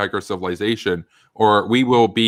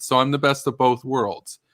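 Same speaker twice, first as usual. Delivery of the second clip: usual speaking voice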